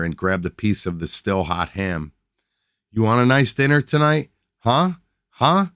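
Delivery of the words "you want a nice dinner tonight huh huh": high, loud mocking taunts; taunting. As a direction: jeer at her louder each time. Drunk husband taunting wife.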